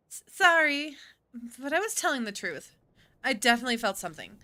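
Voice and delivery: loud, high stammered apology, rushing; flustered girl. Acted. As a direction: blurt it out embarrassed and fast.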